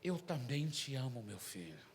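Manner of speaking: low, soft fatherly answer; gentle, hushed retold reassurance; God's tender reply. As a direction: let it drop away very quietly, imitating an inner voice. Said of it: "Mas falou assim".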